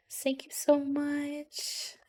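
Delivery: high, very slow drawn-out thank you; sweet high thanks. Acted. Thanking a game character in a slow, high, sugary voice.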